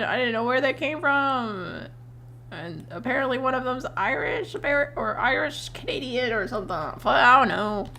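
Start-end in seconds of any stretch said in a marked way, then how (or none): none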